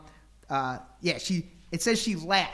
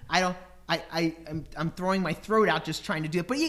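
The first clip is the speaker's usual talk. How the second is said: ordinary talk, nothing marked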